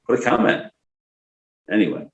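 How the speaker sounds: ordinary talk, nothing marked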